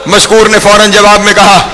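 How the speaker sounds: lamenting preacher at full force; impassioned majlis preaching. Acted. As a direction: climb into full grieving oratory. Majlis narration climbs to wailing peak.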